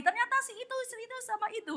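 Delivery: high, very soft gossipy voice; mock-confidential high murmur; hushed gossip. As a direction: leak a juicy secret to friends. Wife passing on secret gossip.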